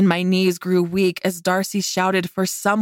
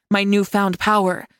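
plain reading voice

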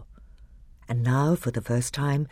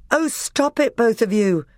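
much higher, louder order to stop; higher commanding king; exasperated king; 10 semitones higher, 5 dB louder than usual. A narrator's reading. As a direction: break up the fight sharply. Caspian breaks up quarrel.

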